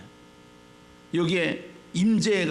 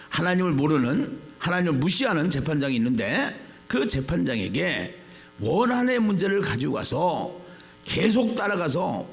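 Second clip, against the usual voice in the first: ordinary delivery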